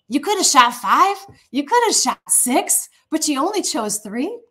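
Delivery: high, lilting reproach; retold incredulous chiding. Prophet chiding king who chose only three, incredulous. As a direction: imitate exasperated disbelief, pitched well up.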